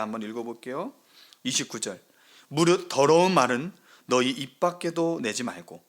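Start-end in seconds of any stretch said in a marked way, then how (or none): 2.5-5.8 loud scripture reading. Acted. Reading scripture aloud to church.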